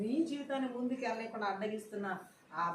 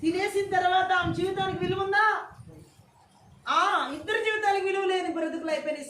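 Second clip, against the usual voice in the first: high, raised preaching voice; fervent sermon delivery, pitched up; impassioned preaching; 8 semitones higher, 7 dB louder than usual. Real, not acted. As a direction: preach it out loudly to the congregation.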